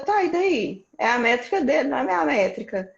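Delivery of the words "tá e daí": high, breezy shrug of a reply; dismissive comeback. Retold or imitated. Telling listeners how to answer a comparison, dismissively. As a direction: toss it off like it doesn't matter.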